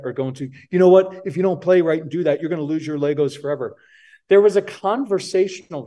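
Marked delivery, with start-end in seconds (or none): 0.7-3.8 mock parent lecture. Parent lecturing and threatening a child.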